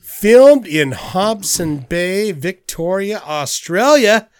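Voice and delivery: slow, stretched-out put-on British accent. Host reading film facts, exaggerated put-on accent. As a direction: read it in a hammed-up British accent. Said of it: "a regular accent".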